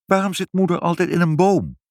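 high-pitched, insistent question; urgent child. A narrator's reading. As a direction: press father for an answer. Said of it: "zei Ibbeltje dringend".

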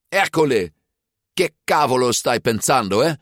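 high, angry scolding shout. A narrator's reading. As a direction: shout at him indignantly. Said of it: "schreeuwde ze". (boos geschreeuwd)

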